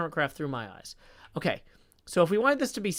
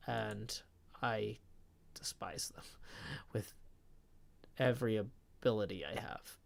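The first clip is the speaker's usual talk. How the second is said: a low, much softer whisper with a stifled laugh; whispered loathing; 7 semitones lower, 13 dB quieter than usual. Real, not acted. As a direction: hiss out the grudge almost secretly. Confiding hatred of Facebook.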